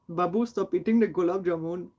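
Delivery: put-on Indian accent. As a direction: scold Babu in a mock Indian accent. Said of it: "shouldn't be doing an Indian accent".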